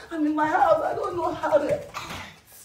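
loud, high celebratory outburst; euforia. Real, not acted. Celebrating wildly at home.

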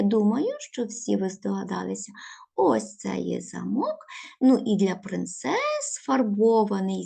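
ordinary talk, nothing marked

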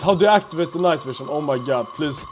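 usual speaking voice

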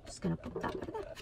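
whispered fast and sneaky; quick mischievous whisper. Real, not acted. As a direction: whisper it like a naughty secret. Sneakily moving the sign.

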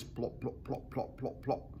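soft, popping imitation of impacts; quiet run of splat sounds; squelching plops. A narrator's reading. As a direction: make quick little muffled plops. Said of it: "a horrible squelching noise".

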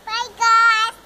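loud happy goodbye shout; shouted bye. Real, not acted. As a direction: yell bye to everyone.

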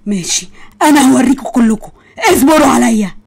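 crying out a vow of revenge; tearful furious threat. Acted. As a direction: shout through tears at them all. Arrested villain vows revenge. (تهديد غاضب بصراخ وبكاء)

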